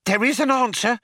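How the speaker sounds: high-pitched, excited wonder; breathless excitement. A narrator's reading. Programmer Fook hears the computer can do it, breathless excitement. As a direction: gasp it out with excitement. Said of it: "said Fook with breathless excitement".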